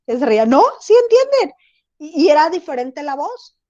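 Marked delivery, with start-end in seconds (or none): 0.5-1.6 much higher and louder, squeaky preschool-teacher voice; mom's shrill teacher voice. Retold or imitated. Mother laughing it off, answering her daughter in a high squeaky preschool-teacher voice. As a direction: chirp it like a kindergarten teacher talking to kids. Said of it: "una voz tan aguda".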